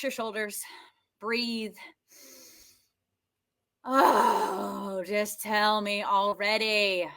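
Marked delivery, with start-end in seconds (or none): 3.8-7.1 slow, drawn-out weary plea; exasperated groan. Shown as a demonstration. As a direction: groan it out, fed up. Voicing frustration with her neck.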